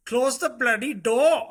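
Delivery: high, loud mock-dad command; imitating kids mimicking dad. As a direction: mimic a kid's send-up of an exasperated dad. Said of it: "do an imitation ... Dad says".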